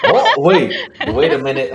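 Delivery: amused, laughing "wait a minute"; laughing interruption. Real, not acted. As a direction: break in through a laugh. Laughing reaction to the accent exchange.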